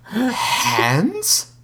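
high, amused question with a chuckle; mock the silly name; chuckling. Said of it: "the Mouth asked with a chuckle".

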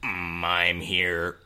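very slow, drawn-out put-on low voice. Shown as a demonstration. Host showing the level right below, slow low voice. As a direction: stretch it out from a low hum. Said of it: "And then right below that is".

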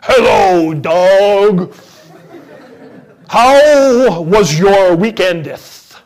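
loud, slow, solemn prayer voice for small talk, hammed up; mock prayer voice. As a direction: greet the dog like reciting scripture. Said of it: "talk to other people in your prayer voice".

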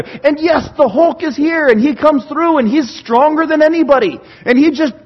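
loud, high-pitched gushing about a hero; excited fan. Acted. Preacher playing a fan raving about superheroes, excited.

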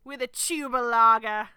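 very loud mock-Australian; Australian accent. Adding to the barbie line, in a loud Australian accent. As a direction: boom it out in broad Australian. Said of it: "only thing that I can say in Australian".

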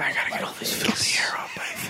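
voiceless, hushed imitation; mock whisper. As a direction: whisper it like a late-night gag. Acting out late-night muttered running gag.

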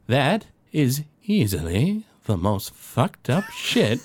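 slow, drawn-out put-on voice; Swampboro. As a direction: stretch each word out in character. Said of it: "you see Swampboro look".

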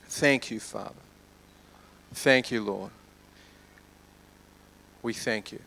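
soft, low prayer with long pauses; hushed prayer. Real, not acted. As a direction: pray gently, letting the voice sink. Leads a prayer.

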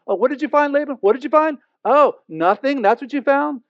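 high, sing-song mock: what did you find; mocking taunt. Jacob taunting Laban before everyone, high mocking sing-song. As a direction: rub it in with a gloating sneer.

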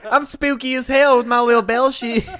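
high southern twang with laughter in it; Phantom Bell man. Pretend. Ghost bellman bragging he is spooky, in a southern accent. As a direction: camp up a southern debonair accent.

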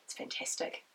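usual speaking voice

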